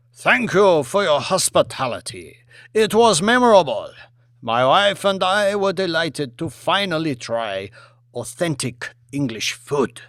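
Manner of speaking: put-on polite premier in a Chinese accent; a formal Chinese dignitary thanking his host.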